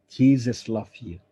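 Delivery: ordinary talk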